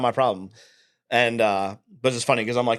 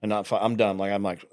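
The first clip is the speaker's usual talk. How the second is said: ordinary delivery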